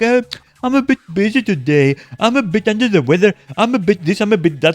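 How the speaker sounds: mocking whiny excuses. Streamer mocking a guildmate's excuses, whiny voice. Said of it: "She was always like".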